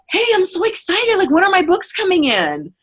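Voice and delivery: bubbly and eager about the books; a light imitation of a thrilled customer; mock excited. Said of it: "maybe they might just be like".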